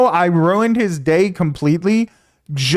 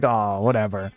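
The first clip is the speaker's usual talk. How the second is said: dismissive shrug; 12 semitones lower, 7 dB quieter than usual. Retold or imitated. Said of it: "but I was like".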